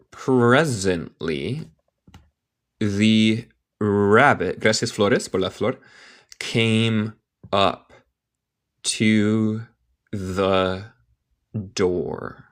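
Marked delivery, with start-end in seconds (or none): 0.1-4.6 very slow, word by word; slow careful reading. Shown as a demonstration. English teacher reading a story to Spanish learners, slowly. 6.4-12.5 word by word with long pauses; slow careful reading. Shown as a demonstration. English teacher reading a story to Spanish learners, word by word.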